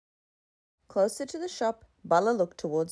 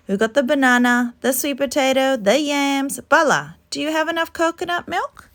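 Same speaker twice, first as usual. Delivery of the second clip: bossy busy auntie; 7 semitones higher, 6 dB louder than usual. Acted. Akka bustling over the feast.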